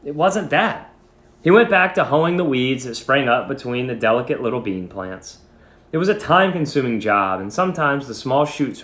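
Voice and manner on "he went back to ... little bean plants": ordinary delivery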